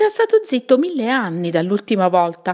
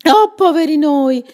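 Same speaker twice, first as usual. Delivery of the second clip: echoing cry; 8 semitones higher, 8 dB louder than usual. Acted. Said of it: "gli fece eco Graziella".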